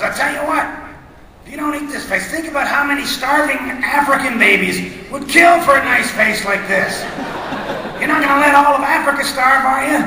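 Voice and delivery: high, loud, hammy guilt trip; blustery cartoon dad. Father guilt-tripping the kids into eating, loud and high. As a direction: browbeat the kids with mock outrage.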